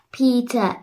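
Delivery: usual speaking voice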